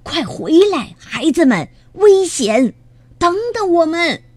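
high, urgent shouts; alarmed, high-pitched calling after them; calling out loudly. A narrator's reading. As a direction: call the youngsters back from danger. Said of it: "只好大声喊道".

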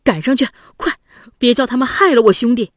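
疯了似的嚷着：大声急喊; frantic shout. A narrator's reading. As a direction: shout it in panic to chase the boat. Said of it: "吉子疯了似的嚷着说". (发疯似的大声嚷)

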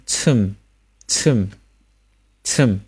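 "침" said as cheum, with an unrounded eu vowel; isolated careful "침", falling tone; final m demonstration. Shown as a demonstration. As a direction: close both lips on the m of "침". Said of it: "刺加收音".

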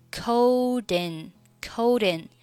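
"coat in" slowly joined as "coh-din"; linked reading. Shown as a demonstration. Teacher showing the link, "coat in" as one word. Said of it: "Coat和in可以连读成".

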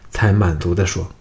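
ordinary delivery

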